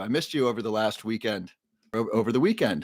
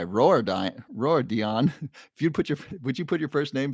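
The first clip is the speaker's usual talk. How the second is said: normal talking voice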